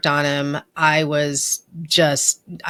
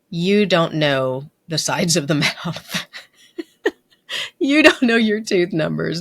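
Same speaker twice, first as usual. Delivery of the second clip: laughing. Retold or imitated. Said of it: "And my boss is laughing".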